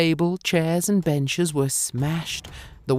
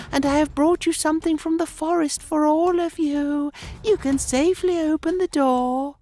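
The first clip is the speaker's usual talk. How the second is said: softest sweetest voice. A narrator's reading. The wolf pretends to be mother home from the forest, high and sugary. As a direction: lure them sweetly as a fake mother. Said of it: "in his softest and sweetest voice".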